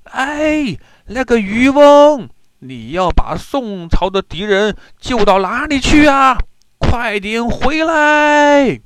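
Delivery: very high, loud long-drawn hollers; soldiers shouting. A narrator's reading. Soldiers on shore ordering a fisherman back, loud high shouting. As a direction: yell across the river, stretching the last words. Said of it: "只听岸上的宋军喊道".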